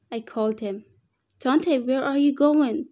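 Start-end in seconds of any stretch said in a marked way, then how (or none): 1.4-2.9 calling out to her son in the woods; calling after him. Acted. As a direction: raise the voice to reach a child walking away. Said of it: "I asked as he kept".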